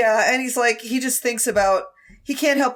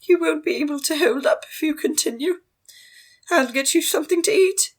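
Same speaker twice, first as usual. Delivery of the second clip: high, refined matron voice; a posh mother coaxing gently; posh lady voice; 9 semitones higher, faster than usual. Acted. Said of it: "Madame Jean's like".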